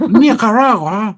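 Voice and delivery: Nicaragua drawn out slowly, high and loud, with a rise; exaggerated Nicaragua. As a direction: stretch Nicaragua high and slow with rising tune. Mock exaggerated pronunciation of Nicaragua.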